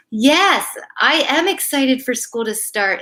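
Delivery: ordinary delivery, nothing marked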